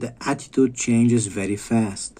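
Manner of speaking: ordinary talk, nothing marked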